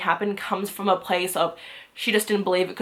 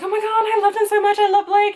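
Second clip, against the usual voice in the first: high, fast-building swooning outburst; gushing fangirl; 12 semitones higher, 5 dB louder than usual. Retold or imitated. Listener swooning over Blake in the audiobook, gushing fangirl. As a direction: gush like a smitten fan.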